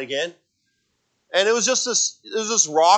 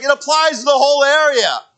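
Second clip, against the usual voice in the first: high, louder mock wife voice; imitating wife; 11 semitones higher, 8 dB louder than usual. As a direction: play the patient wife correcting him. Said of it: "She's like".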